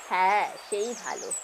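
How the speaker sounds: low, soft agreement after a small laugh; relaxed Radhamohan. Acted. Radhamohan sending the boy for tea, low and soft. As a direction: agree quietly in a low, easy voice.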